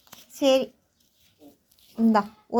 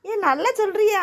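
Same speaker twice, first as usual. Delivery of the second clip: delighted praise; 10 semitones higher than usual. Real, not acted. Praising toddler in high voice.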